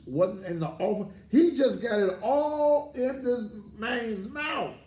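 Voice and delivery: high, loud preacher's cry building; preaching outrage. Acted. Mocking preacher rails at the church scandal in a high loud cry.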